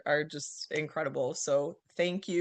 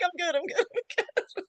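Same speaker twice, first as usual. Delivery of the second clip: much higher, giggling repeats; giggly reassurance; 13 semitones higher than usual. Retold or imitated. Repeating to her boss she's fine, giggling high. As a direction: giggle it off, insisting all is well. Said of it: "I'm like".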